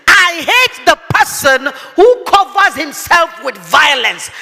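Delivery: slow forceful preaching; emphatic preaching. Acted. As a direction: hammer home each word. Repeating the verse forcefully.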